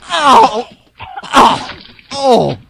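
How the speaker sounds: high, loud, grossed-out yelling; disgusted shout. Real, not acted. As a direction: yell like it's in your nose. Vomited on.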